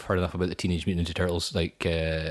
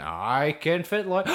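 exaggerated broad Scottish accent, drawn out and higher; slow, high-pitched parody of broad Scots; put-on broad Scots; 7 semitones higher, slower than usual. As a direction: slip into a mock thick Scots. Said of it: "then they change their accent".

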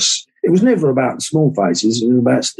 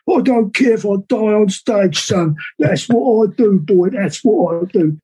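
higher, put-on voice of an old rocker, a bit over the top; 7 semitones higher than usual. Friend imitating an eighty-year-old singer who won't stop touring, pitched-up put-on voice. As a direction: do the old singer who'll gig till he drops, pitched up. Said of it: "that's how he talks".

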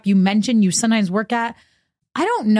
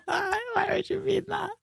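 breathy, laughing, soft, slow; a teary, giggly royal plea; Princess Diana impression; 12 semitones higher, 10 dB quieter than usual. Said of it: "If I meant Harvey".

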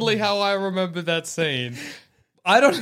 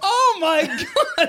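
mock excitement; 11 semitones higher, much slower than usual. Mock delight that Watto lives.

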